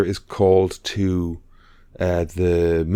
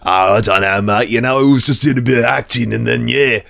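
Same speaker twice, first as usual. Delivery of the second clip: put-on casual British bloke accent, a touch louder; British accent impression; 3 semitones higher, 4 dB louder than usual. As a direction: imitate an actor chatting offhand in interviews with a British accent. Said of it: "when you see him in interviews going".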